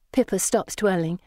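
ordinary delivery

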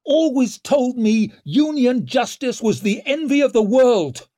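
high, straining, impassioned shout; loud, outraged oratory; angry bellow. A narrator's reading. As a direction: bellow to the hall, voice close to breaking. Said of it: "he bellowed in an ever angrier and more broken voice".

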